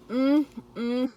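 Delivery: loud delighted mm while chewing; savoring hum. Real, not acted. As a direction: hum with pleasure at the taste. First bite of sandwich.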